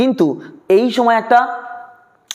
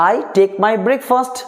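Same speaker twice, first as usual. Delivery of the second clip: slow model sentence; much slower than usual. Shown as a demonstration. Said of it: "প্রত্যেকটা ওই গঠন use করছি".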